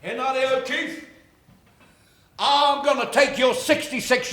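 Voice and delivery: high, emphatic voice of God; imagined divine voice. Retold or imitated. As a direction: declaim each promise like God calling someone, pitched high. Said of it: "This voice says to me".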